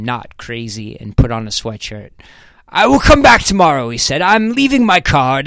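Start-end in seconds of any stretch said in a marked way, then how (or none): 2.7-5.5 higher, eager British businessman voice; a lightly voiced nervous Englishman; British visitor. A narrator's reading. Pancake company rep calling through the door, eager.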